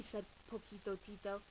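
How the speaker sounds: usual speaking voice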